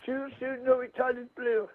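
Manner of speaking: very soft mumbled repeat; quiet repeat. Shown as a demonstration. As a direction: echo it back under your breath. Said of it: "keep saying that over and over".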